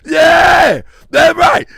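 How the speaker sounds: loud hyped yell; excited shout. Real, not acted. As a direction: shout it out, fired up.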